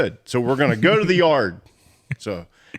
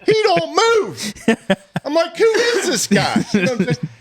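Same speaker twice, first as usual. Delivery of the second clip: laughing; 3 dB louder than usual. Real, not acted. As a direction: laugh through each word. Laughing through the scary moment.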